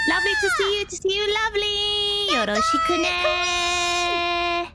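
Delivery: sing-song it way up high; squeaky anime-girl hello; high cutesy greeting. Acted. Cutesy greeting after intro.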